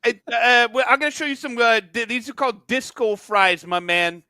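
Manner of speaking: swaggering New Jersey local; boasting about disco fries in a New Jersey accent. Acted. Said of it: "that atypical New Jersey accent".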